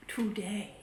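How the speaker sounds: soft whispered ending. Acted. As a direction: breathe the last word. Whispered end of song.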